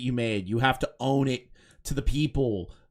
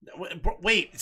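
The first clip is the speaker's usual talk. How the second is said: ordinary delivery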